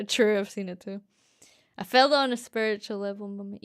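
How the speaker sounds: ordinary delivery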